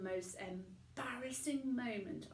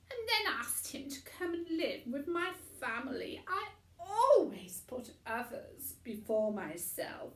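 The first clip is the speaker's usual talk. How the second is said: higher, louder, self-pleased boast; smug Missus Crumb; 4 semitones higher, 7 dB louder than usual. A narrator's reading. Boastful mother showing off to the TV audience, louder. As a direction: preen for the cameras.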